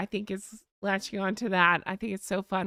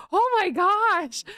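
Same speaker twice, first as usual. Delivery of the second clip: high, loud, drawn-out retold amazement; big re-enacted wow; awestruck gasp; 9 semitones higher, 5 dB louder, slower than usual. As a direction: gush it slowly, acting out tourist awe. Retold awe inside the church.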